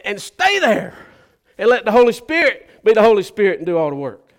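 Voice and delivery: loud, high preaching climax; preacher calling out to congregation; fiery preaching. Acted. Preacher urging the church, loud and high.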